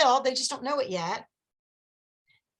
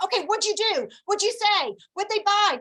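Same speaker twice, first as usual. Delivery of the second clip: eager, higher-pitched mock questioning; excited; 6 semitones higher, 3 dB louder than usual. Friend quizzing the caller about the party, excited. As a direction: imitate a friend hungry for every detail. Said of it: "we all get excited".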